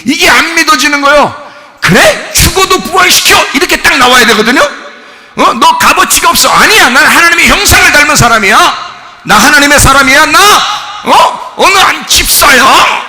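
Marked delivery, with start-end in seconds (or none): 1.8-3.3 defiant shout. Retold or imitated. Voicing defiant believer. 5.6-6.5 loud, high accusing devil. Retold or imitated. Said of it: "이렇게 내려야 되는데". 6.5-13.1 loud, high defiant shout of faith; defiant declaration. Retold or imitated. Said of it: "이렇게 내려야 되는데".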